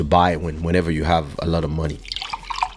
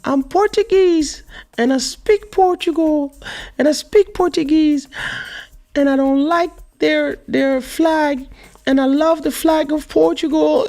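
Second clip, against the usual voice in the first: put-on much higher, upset little girl's voice, close to tears; daughter almost crying; 3 dB louder, slower than usual. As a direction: play the daughter insisting she's Portuguese, on the verge of crying. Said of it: "she started almost crying".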